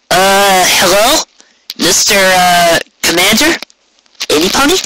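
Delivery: high, unsure calls into the room; hesitant calling out. Acted. Rainbow calling into the commander's empty office, hesitant. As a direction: call out nervously, hoping someone answers.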